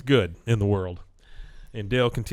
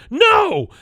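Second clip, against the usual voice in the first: high, loud mock cry of denial; imitated outburst; 9 dB louder than usual. Witness answering the lawyer from the chair, mock shout. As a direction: burst out with a hammed-up denial.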